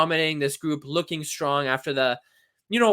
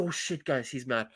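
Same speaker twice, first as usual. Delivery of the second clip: much softer, pretend alarm; mock hushed aside; 4 semitones lower, 9 dB quieter than usual. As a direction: lean in and warn them in a hush.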